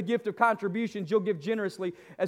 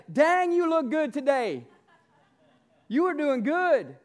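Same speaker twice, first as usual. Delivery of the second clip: cheerful compliment; 4 semitones higher, slower than usual. Pretend. Acted compliment to cashier.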